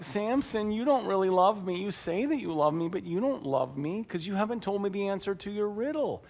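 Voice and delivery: high, soft wheedling complaint; mock tearful pleading. Bride guilt-tripping Samson for the answer, mock tearful. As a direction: plead in a high voice, putting on hurt. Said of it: "she cries and cries and cries".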